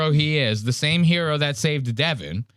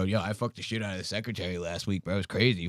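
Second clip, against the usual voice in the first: low, soft slick bro boast; bragging golf bro; 5 semitones lower, 8 dB quieter, faster than usual. Retold or imitated. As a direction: brag it low like a cocky young golfer. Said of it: "They go".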